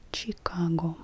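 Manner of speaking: Chicago opening on a hard ch, as in "chip"; Russian ch sound. Shown as a demonstration. Teacher showing the Russian letter, Chicago with a ch sound. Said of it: "Chicago in Russian has the ch sound".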